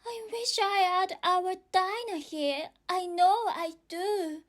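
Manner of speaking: put-on high girl, wishing aloud; a girl talking to nobody; wistful girl voice. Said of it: "said Alice aloud".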